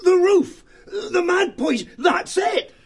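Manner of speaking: very high, loud cry of discovery; excited shout. Acted. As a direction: shout it as it clicks. Sudden realisation, shouting.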